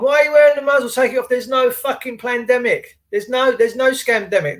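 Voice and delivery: hammed-up ranting retold; retold shouting. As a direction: retell a friend yelling at strangers. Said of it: "he was shouting at everyone".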